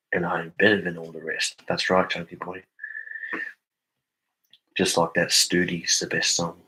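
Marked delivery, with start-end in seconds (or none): none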